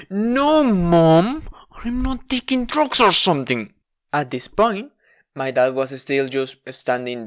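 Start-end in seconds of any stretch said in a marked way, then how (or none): 0.1-3.7 louder, higher shout; angry yell. Retold or imitated. Child yelling at mom about drugs. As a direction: yell the denial, fed up. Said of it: "denying mom's accusation, yelling".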